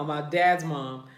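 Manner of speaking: normal talking voice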